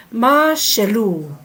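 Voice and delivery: ma shelu with the weight on "ma", slowly; stress on the first part. Shown as a demonstration. Teacher showing students where the stress falls, slow and deliberate. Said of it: "put the accent in the first part".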